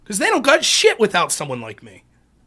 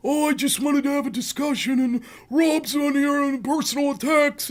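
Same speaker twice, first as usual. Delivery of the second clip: mildly higher, whiny victim impression; mocking her playing the victim, a touch whiny and higher; 3 semitones higher, 4 dB quieter than usual. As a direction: play the wounded innocent, mocking. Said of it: "she plays the victim".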